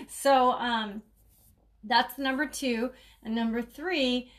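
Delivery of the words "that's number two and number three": normal talking voice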